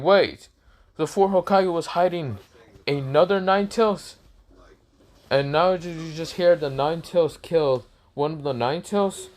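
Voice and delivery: high-pitched, incredulous questioning; shocked villagers. Acted. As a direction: gossip in disbelief, pitch up. Acted villagers spreading shocking news.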